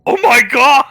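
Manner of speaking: loud astonished cry; nearly faint from the shock; shocked gasp. Acted. Little girl handed eight million credits, loudly shocked. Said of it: "She almost faints".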